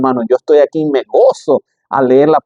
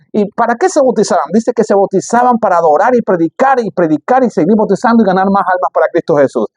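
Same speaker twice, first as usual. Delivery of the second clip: raised, ringing preacher's voice, higher pitch; fiery preaching; 4 semitones higher than usual. Acted. Pastor stirring up the church about baptisms, fervent preaching. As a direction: preach it out to the congregation.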